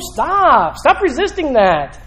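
high-pitched, forceful preaching; fired-up accusing sermon cry; impassioned rebuke. Acted. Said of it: "he's telling them".

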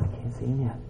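very soft, low murmur; quiet, resigned searching; hushed self-talk. Acted. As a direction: say it under your breath. Father searching the horizon.

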